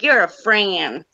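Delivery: slow drawn-out Southern "You're a Fran", with "Fran" stretched long; Southern accent, "Fran" pulled into extra syllables; Southern drawl. Retold or imitated. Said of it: "You've gotta say that thing with an accent".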